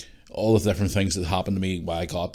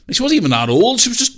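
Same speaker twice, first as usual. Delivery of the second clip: usual speaking voice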